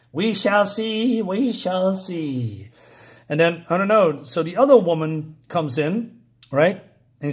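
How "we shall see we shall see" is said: very slow, drawn-out theatrical menace; ominous villain queen. Acted. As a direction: stretch it out like a scheming ruler. Acted villain's ominous reply.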